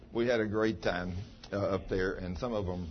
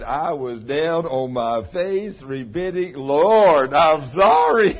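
slow, loud cry of repentance; loud repentant wail; 4 semitones higher, 11 dB louder, much slower than usual. Retold or imitated. Pastor mimics Deborah repenting before the Lord, slow loud wail. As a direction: wail it out, sorry and pleading. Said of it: "she said".

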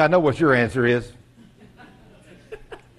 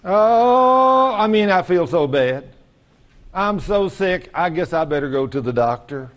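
hammed-up sickly whining; complaining groaner; slower than usual. Churchgoer feeling sick and bad, exaggerated groaning complaint. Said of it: "do you hear people talk like that?".

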